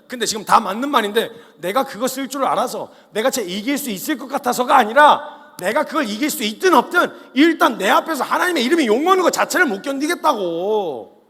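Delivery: loud, high, impassioned sermon; full-force preaching. Real, not acted. Preacher urging the youth, passionate and loud.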